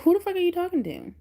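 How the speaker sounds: high-pitched, drawn-up pretend outrage; mock indignant comeback. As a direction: challenge him in exaggerated indignation. Retold comeback to son's bruh.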